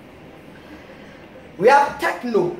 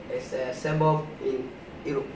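ordinary talk, nothing marked

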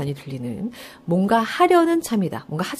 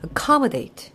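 "accommodate" stressed on COM, not the first syllable; stress on second syllable; 4 semitones higher, much slower than usual. Shown as a demonstration. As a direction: hit COM in "accommodate" higher, then fall away. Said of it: "강세 어디 들어가는 걸로".

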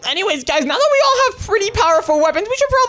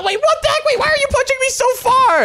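high-pitched startled outcry; acted panicky disbelief; alarmed protest; 9 semitones higher than usual. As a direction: blurt it out as you get knocked back. Being knocked back in a fight.

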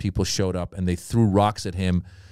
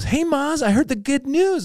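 high-pitched chirpy caller; gushing congratulations. Retold or imitated. Club booker phones comedian, high excited greeting. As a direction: burst in with good news.

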